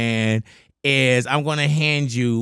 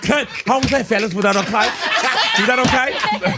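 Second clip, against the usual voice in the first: insecure actor; 6 semitones higher than usual. Acted. Acting out actor checking the take.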